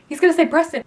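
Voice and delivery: excited anticipation. Retold or imitated. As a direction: build the thrill on the second take. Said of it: "I was getting so excited".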